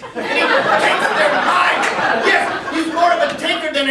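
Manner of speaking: slow loud mock-sinister proclamation; mock menacing boom. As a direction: draw out the horror line like a trailer. Mock horror announcement.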